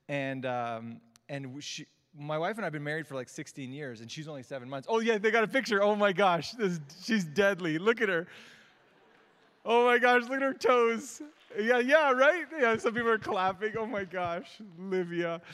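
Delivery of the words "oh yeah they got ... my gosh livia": excited, doting outburst; gushing proud parent. Real, not acted. Gushing over baby daughter's photo.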